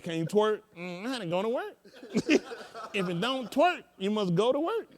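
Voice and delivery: high, slow, laughing sing-song rhyme; mock rhyme. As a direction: deliver it like a playful proverb, laughing. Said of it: "He said".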